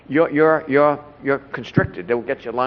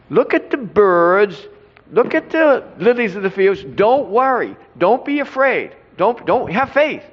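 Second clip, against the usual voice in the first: higher, lilting preacher's voice as Jesus, lightly done; voicing Jesus; 7 semitones higher than usual. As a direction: preach it gently and much higher, as Jesus teaching.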